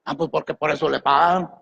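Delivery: mock excuse. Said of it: "por qué hablarle al pastor".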